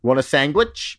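"sandwich" said as "sangwich"; quoted mispronunciation. Retold or imitated. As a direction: ask it with "sandwich" turned into sang-wich. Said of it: "he says sandwich".

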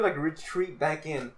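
usual speaking voice

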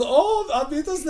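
very high, gleeful German farewell; happy German accent. Retold or imitated. Ride announcer sends riders off, happy German accent. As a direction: chirp a German-accented goodbye. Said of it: "but he's so happy".

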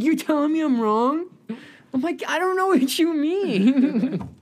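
high, slow dazed voice; high stoned voice. Retold or imitated. Stoned friends confused by the seventies remark, high dazed voice. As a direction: drawl it high and baffled.

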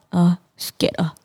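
slow, whispery mumble; soft mock-timid answer, almost voiceless; imitating meek speaker. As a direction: imitate him answering quietly and slowly. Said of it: "He sound like this".

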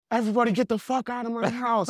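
high imitated yell of "Everybody get the fuck out of my house"; retold scream. Angry party host ordering everyone out, imitated high yell. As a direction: mimic the homeowner screaming, pitched high. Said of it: "He's, like, still screaming".

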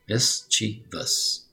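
MISCHIEVOUS stretched into three separate syllables; teacher spacing out each syllable of "mischievous"; slow syllable-by-syllable. Shown as a demonstration. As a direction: sound out "mischievous" very slowly. Models the word slowly, then normally.

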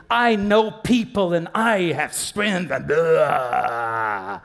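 slow, put-on bragging voice; hammed-up self-important brag; mock boaster. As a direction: drag out the boast, ending in a mocking blah. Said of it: "Because you are saying".

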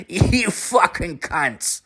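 put-on slow, high Newfoundland accent insult; high put-on Newfoundlander voice, slowed down; [name]'s Newfoundland twang. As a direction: draw out the curse in a mock Newfoundland twang. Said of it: "like, this weird twang".

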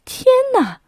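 惊呼; shocked cry. Acted. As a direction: yelp it in alarm. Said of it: "妈妈嘟囔着".

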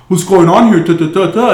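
acted authority shout; mock police challenge. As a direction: imitate officers demanding answers. Cops burst out and confront kids.

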